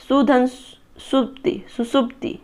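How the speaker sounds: सुधन सुप्ति drawn out slowly, then सुसुप्ति very soft; teacher sounding out सुसुप्ति syllable by syllable; slow spelled-out sandhi demo. Shown as a demonstration. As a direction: split it slowly, then join it softly. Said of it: "अनुधन संगी".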